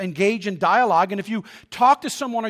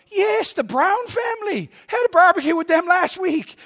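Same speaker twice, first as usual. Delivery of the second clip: mock eager yes; 9 semitones higher, 4 dB louder than usual. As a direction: overplay a cheerful fib to the lady. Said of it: "I went".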